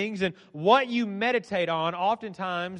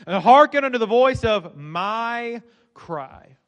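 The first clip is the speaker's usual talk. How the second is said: very slow line, "my" set apart and stretched; stress on my; 3 dB louder, much slower than usual. A narrator's reading. Preacher showing the psalmist makes it personal, stressed "my". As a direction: hold out "my" with weight before "cry". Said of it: "My, my, and my".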